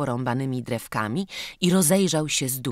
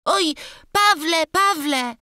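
put-on high, loud childish wail of a name; a panicked boy bursting in calling for Paweł; loud high-pitched cry for help; 6 dB louder than usual. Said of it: "krzyknął".

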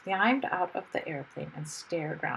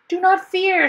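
high, ringing heroic shout; loud shout; 12 semitones higher, 12 dB louder, slower than usual. A narrator's reading. Hero to trembling crowd as dragon roars, loud shout. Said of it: "shouted Mister Wumble loudly".

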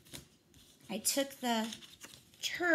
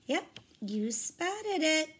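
usual speaking voice

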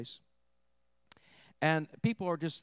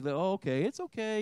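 mock easygoing reassurance, rising high; mock permissive; 5 semitones higher than usual. Preacher parodying a tolerant response he is not suggesting, mock permissive. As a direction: lightly mimic someone letting it slide, pitch lifting higher. Mimics someone excusing harmful behavior.

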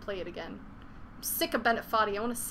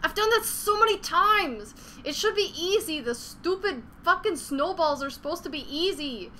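much higher and louder angry outburst; furious streamer yelling at a hard climb; frustrated rant; 6 semitones higher, 4 dB louder than usual. Real, not acted. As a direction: yell it at the game in real frustration. Raging after falling again.

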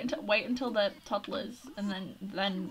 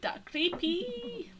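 creeped out; 10 semitones higher, much slower than usual. Real, not acted. Reacting to creepy teen Sim.

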